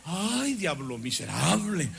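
low, slow disgusted grumble; waking up cursing the devil, low and slow; groggy curse. Retold or imitated. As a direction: growl it awake at the devil.

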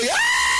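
sudden high, loud scream of panic; panicked cry. Retold or imitated. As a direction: shriek like people caught in the flood. Crowd panicking in flood. (grito agudo de pánico)

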